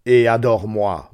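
lent, plus fort, menaçant; voix terrible. Acted. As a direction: ordonne comme un maître redoutable. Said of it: "me cria-t-il d'une voix terrible". (ordre crié d'une voix terrible)

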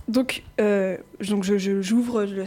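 usual speaking voice

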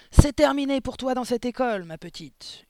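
high, loud fury, dropping at the end; enraged headmistress yell; howled threat. A narrator's reading. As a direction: shout it as a final verdict. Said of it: "hurla-t-elle".

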